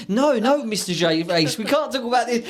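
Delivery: high eager Elmo puppet. Retold or imitated. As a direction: pitch it up as the puppet. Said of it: "And Elmo goes".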